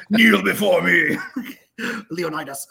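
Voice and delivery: a hint of a booming king, slow and laughing; hammed-up slow royal command through laughter; mock Spartan king. Director to podcast host, mock king from 300, slow and laughing. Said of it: "like I'm from fucking three hundred".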